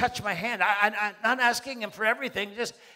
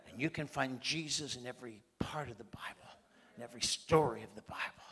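whispered, hushed aside; a preacher drops to a whisper to the congregation; 8 semitones lower, 11 dB quieter than usual. Real, not acted. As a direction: lean in and whisper it. Intimate aside in sermon.

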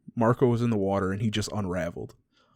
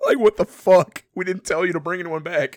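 much higher and louder, exasperated; mock outraged yell; 9 semitones higher, 7 dB louder than usual. Military officer scolding soldiers for bringing someone back, outraged yell. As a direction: bark it like an officer who can't believe this.

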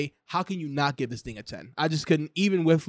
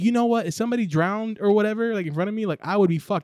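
higher, louder flustered cry for help; mock panic; 5 semitones higher, 3 dB louder, faster than usual. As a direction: act out helpless panic, pitched up. Imagined panic at a drowning.

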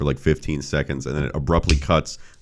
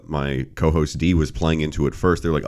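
ordinary talk

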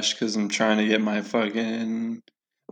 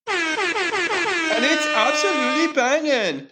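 high, slow, hammed-up German accent; over-the-top German accent, stretched out slowly; mock German accent; slower than usual. Host praising his gin in a hammed-up German accent. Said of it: "started out Jamaican and then became German".

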